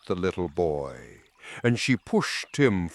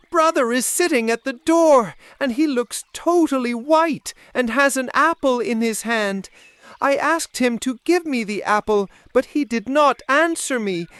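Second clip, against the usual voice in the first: put-on higher, louder child voice, upset and scared; frightened little girl; 11 semitones higher, 4 dB louder than usual. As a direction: Marlene rushing in, frightened by her brother. Marlene reports to her mother.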